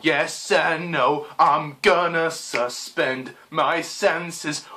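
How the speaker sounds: slow, drawn-out mock singer; mocking lyric recital. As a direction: drag out each lyric word mockingly. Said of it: "the lyrics are just so like".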